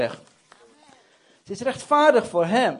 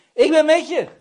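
high, loud proclamation; ringing, emphatic cry of reassurance; shouted assurance; 7 semitones higher, 9 dB louder than usual. Acted. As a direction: shout the promise out. God's answer repeated as a shout.

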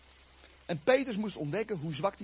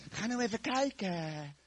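slow, drawn-out gentle plea; patient coaxing; 3 semitones higher, 4 dB quieter, slower than usual. Acted. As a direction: wheedle him, stretching the words. John coaxing again, drawn out.

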